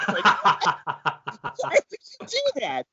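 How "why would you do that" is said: high, exasperated why; baffled. Real, not acted. Filmmaker asking why anyone would threaten him, high and baffled. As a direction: pitch climbs in disbelief.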